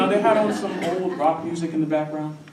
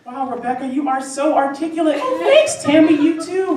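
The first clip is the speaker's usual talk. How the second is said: high, loud mock compliments; Rebecca and Tammy; 12 semitones higher, 8 dB louder than usual. Two women praising each other, high exaggerated gushing. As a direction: gush back and forth in a pitched-up voice. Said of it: "I have never once heard this".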